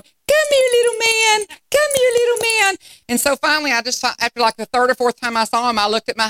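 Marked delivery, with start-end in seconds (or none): 0.0-2.8 put-on very high, teary baby-talk coo; squeaky loving puppy coo. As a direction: call the puppy over with love. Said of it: "I'd see me going".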